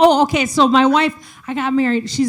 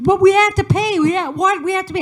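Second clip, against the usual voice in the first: panicked wife; 9 semitones higher than usual. Retold or imitated. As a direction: sound the alarm about the cost. Wife panics about paying.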